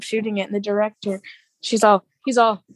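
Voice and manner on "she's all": ordinary talk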